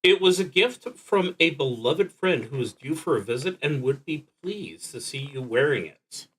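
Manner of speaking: usual speaking voice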